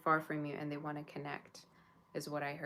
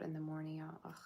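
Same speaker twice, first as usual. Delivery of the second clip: whispering; 7 dB quieter than usual. Real, not acted. Complaining about a loud pet.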